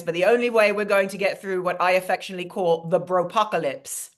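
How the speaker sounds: put-on chatty British accent; British-accented host addressing an audience; British alter ego. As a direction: play a gracious British guest speaker. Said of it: "bring my British alter ego".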